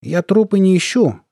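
high-pitched perky reply; brisk and cheery. A narrator's reading. Soldier answering the colonel, cheery. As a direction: answer chirpily, unbothered. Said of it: "бодро ответил Дзот".

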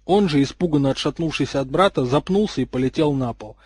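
usual speaking voice

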